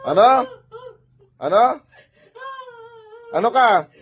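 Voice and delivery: high, loud, insistent questioning; stern interrogation. Real, not acted. Healer confronting spirit about bothering the patient, stern interrogation.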